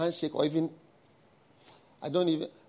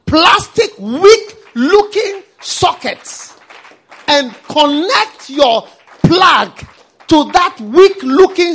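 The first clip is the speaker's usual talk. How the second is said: full-force preaching; 9 semitones higher, 13 dB louder than usual. Acted. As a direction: hammer each phrase at the congregation.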